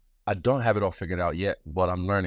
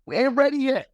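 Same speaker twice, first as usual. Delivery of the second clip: high playful voice. Retold or imitated. Voicing his body playfully.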